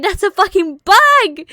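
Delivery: startled, loud panicked outburst; scared yelp. Retold or imitated. Seeing a blue moth in the kitchen, scared yell. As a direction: jump back yelling at the bug. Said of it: "I got scared. I was like".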